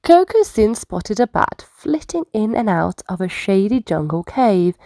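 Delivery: ordinary delivery, nothing marked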